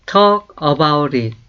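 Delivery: very slow, each word laid out for learners; slow model phrase. Shown as a demonstration. Teacher reading slowly to learners. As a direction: say it slowly for students to repeat. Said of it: "다시 한번".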